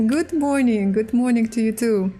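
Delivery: normal talking voice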